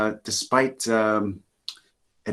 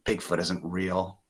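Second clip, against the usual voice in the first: doubtful; 4 semitones lower, 8 dB quieter, slower than usual. Acted. Doubting townsfolk.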